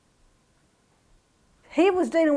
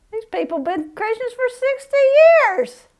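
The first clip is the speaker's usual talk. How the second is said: exasperated prayer; 9 semitones higher than usual. Retold or imitated. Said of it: "I'm just going, "Lord".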